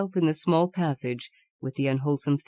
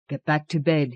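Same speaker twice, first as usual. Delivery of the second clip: ordinary delivery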